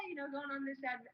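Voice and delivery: usual speaking voice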